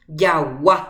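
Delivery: Jagua with a loud final A; stress on final A. Shown as a demonstration. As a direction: push the last A of "Jagua" hard. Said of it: "Ja'e A ári jajepa". ("Jagua" con la A final más fuerte)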